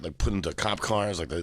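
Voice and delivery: ordinary talk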